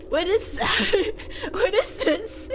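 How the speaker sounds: baffled question squeezed out through laughter; helpless giggling. Real, not acted. Streamer baffled by slang from chat, giggling helplessly. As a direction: giggle so much the slow words barely come out.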